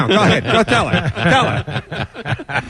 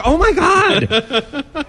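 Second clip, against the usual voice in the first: mock swoon; 6 semitones higher, much slower than usual. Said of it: "all the guys sitting around like".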